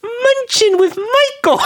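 very high, slow, silly voice; Munch voice. Pretend. Co-host asked for the name in the Munch voice. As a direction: stretch it out in a squeaky, laughing voice. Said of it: "the Munch voice".

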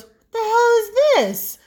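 high, loud incredulous husband; mock outraged husband demanding an answer; imitating the husband's high indignant cry; husband's outburst. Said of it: "comes out of our bedroom and goes".

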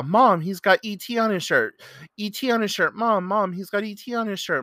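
high, loud, urgent calling out to Mom; loud excited young man. Retold or imitated. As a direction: imitate an overexcited fan who can't contain himself. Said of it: "you could hear him before you could ever see him".

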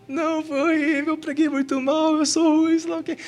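high-pitched wailing self-mockery; mock despairing whine. Mimicking own despairing, whiny reply.

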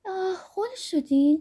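high, slow, lilting tease with a giggle; playful giggling reproach on «خل شدین»; melodious little laugh. A narrator's reading.

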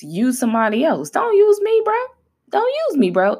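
high-pitched mock plea to be spared; pleading refusal. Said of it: "how Moses was like".

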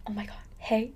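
hushed excited greeting; breathless whispered hello; whispered hey. Retold or imitated. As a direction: whisper it across the room. Greeting friend across dining hall.

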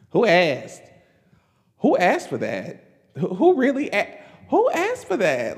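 incredulous brush-off, going up and up; sassy dismissal. Retold or imitated. Her reaction to Malcolm Moore's comeback, mock outraged. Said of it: "And I'm like".

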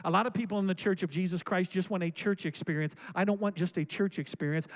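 usual speaking voice

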